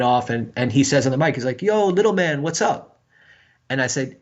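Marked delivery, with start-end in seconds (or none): 1.6-3.0 calling out greeting. Retold or imitated. Bandmate hails the younger musician over the mic, calling out. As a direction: call out a breezy hello, pitched up.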